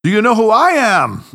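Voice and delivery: about to burst out laughing, teasing; mocking echo. A narrator's reading. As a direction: parrot him back in playful glee. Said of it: "asked Tanaka as he burst into uncontrollable laughter".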